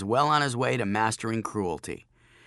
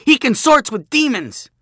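high, loud accusing scream; panicked scream; 13 semitones higher, 11 dB louder than usual. Acted. Bully denouncing Joshua over a snake, high scream. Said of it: "Jachin screamed".

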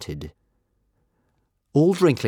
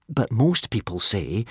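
slow, careful; slowly; 3 semitones higher than usual. Acted. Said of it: "said Hiccup slowly".

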